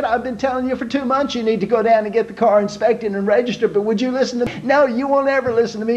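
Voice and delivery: wife scolding her husband in front of the officer; exasperated nagging wife. Acted. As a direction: nag him relentlessly.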